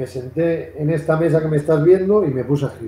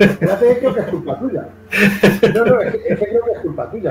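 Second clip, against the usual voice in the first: laughing protest; 6 semitones higher than usual. Real, not acted. Host teased over mispronounced surname.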